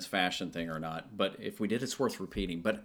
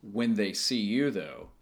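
ordinary talk, nothing marked

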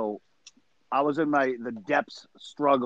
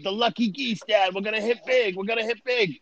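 higher, hyped-up teasing of dad; mock excited cheer; 7 semitones higher than usual. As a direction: cheer it like a gambling omen. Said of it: "I was going".

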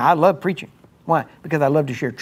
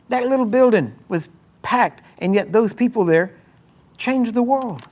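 full-force preaching; 6 semitones higher than usual. Acted. Pastor firing up his church, full preaching voice. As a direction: drive it home to the congregation.

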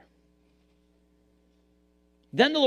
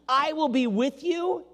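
higher and slower, emphasis on you; quoted promise; 7 semitones higher, slower than usual. Acted. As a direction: lift the promise gently, landing on you. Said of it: "says to us".

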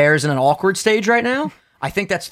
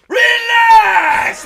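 much higher, much louder barked relax; screamed relax; 6 dB louder than usual. Retold or imitated. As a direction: yell it like the barber slapping you. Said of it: "I try my best to not scream that loud".

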